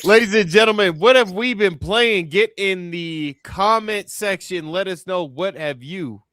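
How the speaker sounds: high, loud showman call to the audience; announcer. Acted. Host calling on viewers in a loud announcer voice. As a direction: open it up like a ringmaster, drawing out the ask.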